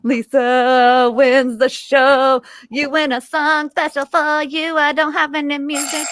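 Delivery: high, slow game-show announcer; hammed-up prize announcer; mock announcer.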